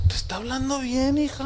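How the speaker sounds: high, soft imitated mom; mother soothing daughter. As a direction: play a placating mother, quietly. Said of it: "su mamá le dice".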